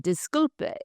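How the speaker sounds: mock language-learner's Spanish accent; imitating Spanish pronunciation. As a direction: parrot the app's Spanish accent with gusto. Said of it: "that's how the man says it".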